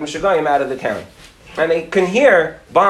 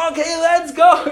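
high-pitched urgent holler; acted yell to hurry a friend along; calling out; 11 semitones higher than usual. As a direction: call out high and eager. Friend urges running friend on.

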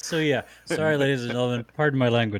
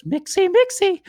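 TikTok bartender impression; 8 dB louder than usual. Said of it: "this guy on TikTok that goes".

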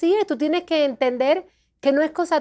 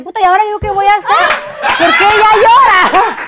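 fast, loud and higher, half laughing; panicked; 6 semitones higher, 8 dB louder than usual. Retold or imitated. Retelling her panic when another cried first, loud. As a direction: blurt it in a comic panic.

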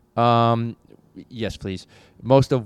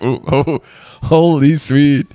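louder, slow gasping "holy sweet"; imitating a friend's startled gasp at the burn; mock Chris burned by whiskey; 4 semitones higher, 7 dB louder, slower than usual. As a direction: ham up the sting of hot whiskey. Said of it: "when Chris is like".

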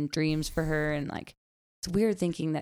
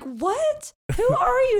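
very high squeaky what, who are you; high delighted disbelief; mock surprise; 5 dB louder, slower than usual. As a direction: gasp it out like a startled mom. Mom greeting toddler, mock surprised.

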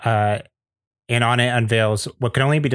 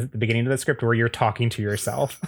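normal talking voice

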